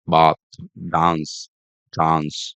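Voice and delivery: BATH, DANCE, CHANCE with a long open 'ah'; drawn-out British 'ah' in bath, dance, chance. Shown as a demonstration. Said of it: "use a long A".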